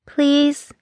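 high, small pleading voice. Acted. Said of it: "She bit her lower lip".

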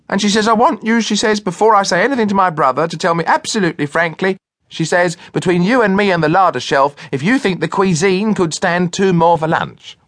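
high, gushing society lady; imitating a lady. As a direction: ape a bluff gracious lady. Cook imitates Miss Cartell.

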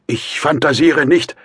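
high, loud, desperate insistence; strained, effortful outburst; mustering last strength. A narrator's reading. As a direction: force out an ultimatum with all you have left. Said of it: "rief Raskolnikow unter Aufbietung seiner letzten Kräfte".

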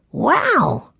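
put-on high-pitched child's wonder; little boy taken aback; amazed young boy. A narrator's reading. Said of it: "thought Benny".

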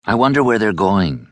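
plain reading voice

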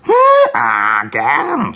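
loud, high donkey cry; hee-hawing donkey shout; braying donkey. Acted. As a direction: bray out an eager yes. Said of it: "schrie der Esel".

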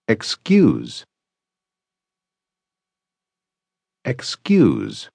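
slow, separated drill syllables; over enunciating each piece. Shown as a demonstration. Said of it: "repetir esta expressão sílaba por sílaba".

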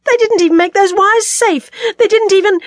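loud, high-pitched fury; incensed, voice thrown high and loud; outraged protest. A narrator's reading. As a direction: cry out in indignation, breaking off. Character raging at wrecked wiring.

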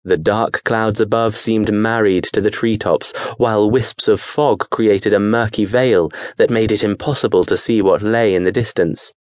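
plain reading voice

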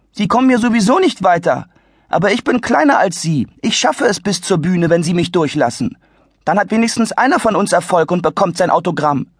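put-on a clever kid talking his way past; eager, well-spoken boy; earnest boy. Adi persuades the lady.

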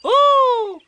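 very high, loud excited squeal. A narrator's reading. Roo hearing Eeyore's news, high excited squeal. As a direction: squeal with a little kangaroo's delight. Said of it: "said Roo excitedly".

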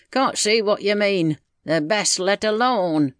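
lightly voiced village man, resigned; grumbling country husband. Husband discussing his deaf wife with John, grumbling. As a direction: complain in a country man's character voice.

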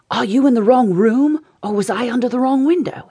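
ordinary delivery, nothing marked

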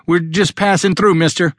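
high-pitched call to a stranger. A narrator's reading. Alan to the hidden man, calling out. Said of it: "Alan called out".

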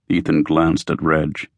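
usual speaking voice

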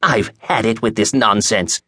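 put-on slow, fed-up old woman's voice; incensed old woman. A narrator's reading. Old woman about to throw book at clerk, incensed. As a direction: draw it out, at the end of your patience. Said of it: "the woman said".

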